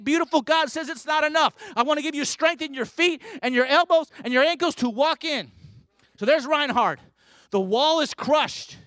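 loud, high, rousing sermon delivery; preaching at full force. Acted. Preacher rousing the congregation, at full force.

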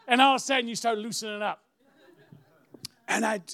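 ordinary talk

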